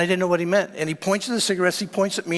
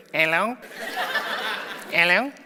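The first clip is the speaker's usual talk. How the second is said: funny squeak like Tattoo from Fantasy Island; imitating a comically squeaky man; squeaky little voice. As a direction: squeak it out, rising at the end. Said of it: "He had this squeaky voice".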